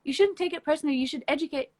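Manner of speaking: high, prissy mimicry of people who advise her; mocking advice voice. Mocking people who say she should educate, high mimicry. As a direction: mimic the condescending advice giver. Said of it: "mocking others' advice".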